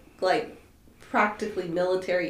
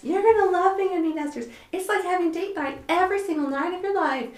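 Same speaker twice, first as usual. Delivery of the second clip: high, quick, gushy sing-the-praises quote; gushing mock enthusiasm; 9 semitones higher, faster than usual. Friends promising a couple bliss as empty nesters, gushing in mimicry. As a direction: imitate overexcited friends selling a dream.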